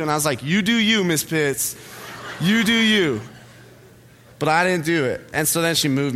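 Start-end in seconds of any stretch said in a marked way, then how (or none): 0.4-3.2 higher, punchy mock sass; defiant retort. Student daring his teacher to move him, sassy defiance.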